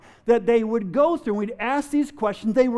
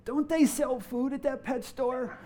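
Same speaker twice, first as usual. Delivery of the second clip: faint, softer parrot voice, barely there; a dying parrot's last feeble words; a weak little croak of a voice; 2 semitones higher, 7 dB quieter than usual. Acted. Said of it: "a very weak voice".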